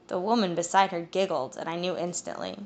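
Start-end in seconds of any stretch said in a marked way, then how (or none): none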